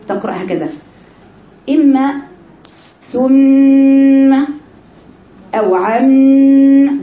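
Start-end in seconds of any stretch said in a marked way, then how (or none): 3.0-7.0 ثم and عم hummed long, higher and much louder through the nose; مبالغة في غنة "ثم" و"عم" بصوت أعلى; exaggerated ghunna. Shown as a demonstration. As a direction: overdo the nasal hum on ثم and عم. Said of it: "ده مبالغة".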